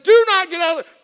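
loud, high mock command; mom's warning. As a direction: belt out mother's order. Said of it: "My mom said".

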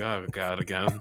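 low, soft resigned groan of words; retold weary resignation; weary dread.

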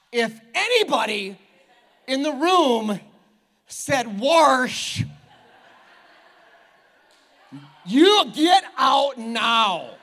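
loud, slow hammed-up banishing; mock outraged shout. Pastor banishing the warsh sayers, slow mock shout. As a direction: thunder it out in playful outrage.

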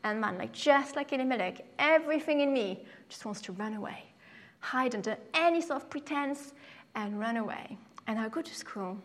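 usual speaking voice

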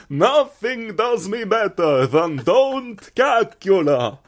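high, loud, slow Dracula testimonial; vampire advert pitch. Acted. Dracula endorsing his tummy remedy to viewers, hammed-up slow vampire. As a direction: ham it up as a vampire selling a remedy.

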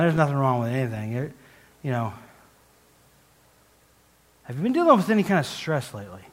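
usual speaking voice

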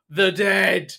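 hammed-up spooky old man; dramatic ominous line. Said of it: "And, like, really dramatic".

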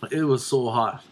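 ordinary talk, nothing marked

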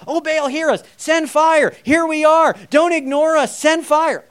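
high, loud frantic pleading to an idol; mock prophets crying out to Baal. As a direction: beg the idol for fire, ham it up. Mocking Baal prophets' frantic prayer.

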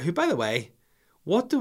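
usual speaking voice

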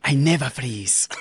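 slow mock hero line; Andy Cortez impression. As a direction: draw it out as a movie impression. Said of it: "three Andy Cortez M C U impressions".

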